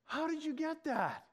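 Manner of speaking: high, lightly voiced woman in wide-eyed surprise; amazed woman. Australian agent stunned her accent was guessed, high amazed question. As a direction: let her amazement pitch it up.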